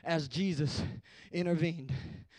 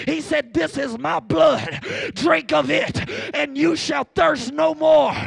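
high, loud preacher shout; full-force preaching; 7 semitones higher, 10 dB louder than usual. Real, not acted. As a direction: drive each phrase home at full volume.

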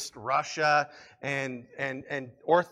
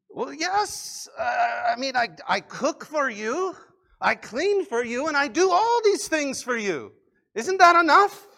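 high, protesting wife, louder at the end; hammed-up indignant protest in a high voice; exasperated wife; 10 semitones higher, 8 dB louder than usual. Wife defending all she does for husband, exasperated.

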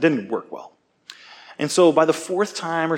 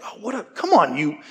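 higher, mildly exasperated imitation of his own anger; mock mad outburst; 5 semitones higher than usual. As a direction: huff it out like a half-joking angry rant. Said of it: "I was, like, mad".

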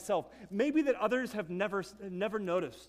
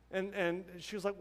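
ordinary talk, nothing marked

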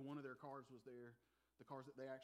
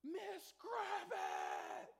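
high, drawn-out panicked scream of the name; long frightened yell, much higher and louder; scared scream; 9 dB louder, much slower than usual. Retold or imitated. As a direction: shriek for help like a terrified kid. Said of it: "I screamed out and I said".

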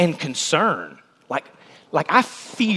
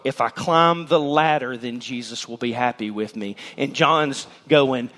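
usual speaking voice

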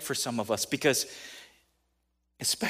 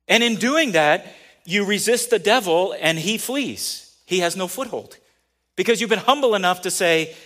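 preaching at full force; 5 semitones higher, 8 dB louder than usual. Acted. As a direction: lean into each word, louder.

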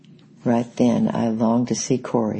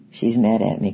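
plain reading voice

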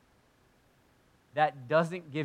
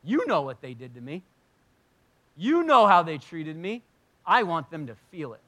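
acted indignant complaint, building louder; a little indignant; 6 dB louder than usual. Preacher imitates himself complaining to God, a little indignant. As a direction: protest with mounting righteous grievance. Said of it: "I get a little indignant".

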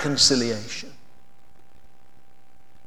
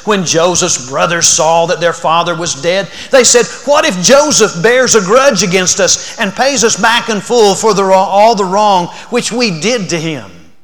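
loud, raised reading of Genesis; fervent scripture reading; 4 semitones higher, 10 dB louder than usual. Acted. Preacher reading Joseph's brothers' plea to the congregation, raised and fervent.

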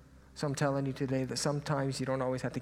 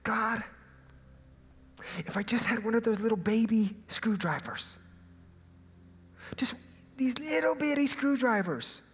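higher, pleading little whine; whiny plea to God; 7 semitones higher than usual. Retold or imitated. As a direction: beg God like a sulking kid. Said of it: "I'm like".

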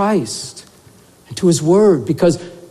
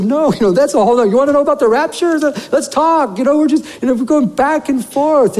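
eager retold reply; 8 semitones higher than usual. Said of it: "I said".

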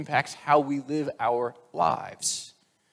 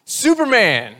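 loud, high superhero cry; exclaimed comic-book title; 6 semitones higher, 9 dB louder, slower than usual. Acted. Sibling reading a comic answers his brother, exclaiming. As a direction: announce it like a superhero's arrival.

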